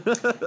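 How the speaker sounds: a quick high giggle; a brief bright laugh after a joke; short laugh. Real, not acted. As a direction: giggle after teasing a co-host. Laughing at his own guess.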